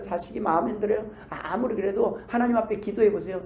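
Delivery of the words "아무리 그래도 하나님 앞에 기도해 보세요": ordinary talk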